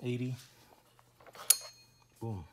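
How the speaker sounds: normal talking voice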